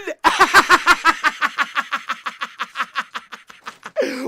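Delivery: loud high laugh of pure joy; ecstatic laughing shout. Real, not acted. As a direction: howl with laughter after the goal. Laughing after goal.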